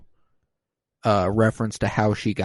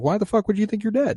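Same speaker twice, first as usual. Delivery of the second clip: high, quick baffled retort; mock incredulous; 12 semitones higher, faster than usual. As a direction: imitate his disbelief in a much higher pitch. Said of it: "And he's like".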